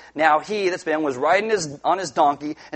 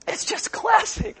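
high delighted exclaim; slower than usual. Real, not acted. Repeated delighted remark.

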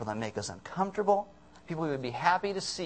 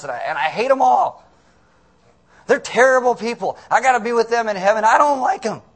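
ranting, building to a high, loud outburst; freaking out; 3 semitones higher, 7 dB louder than usual. Retold or imitated. Preacher voices Jonah venting about people, freaking out. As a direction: work the complaint up into a loud mock tantrum. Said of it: "he's just freaking out".

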